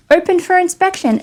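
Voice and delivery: high, sarcastic reading of the sticker; sing-song sarcastic quote; mocking.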